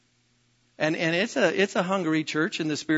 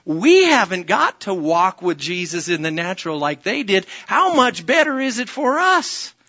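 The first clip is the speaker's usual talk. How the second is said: loud, high, driving preacher's cry; a fiery pastor calling out to the church; full-force preaching; 5 semitones higher, 7 dB louder than usual. Acted. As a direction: preach it hard to the whole room.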